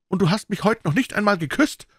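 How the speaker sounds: angry shout. A narrator's reading. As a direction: hurl the reproach at her. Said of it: "schrie er".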